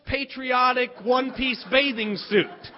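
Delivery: ordinary talk, nothing marked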